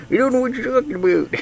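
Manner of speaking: put-on high, fast old-timer voice; old man voice. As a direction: croak it like a crotchety old man. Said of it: "It's my old man voice".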